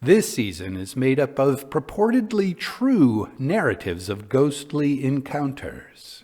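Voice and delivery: ordinary delivery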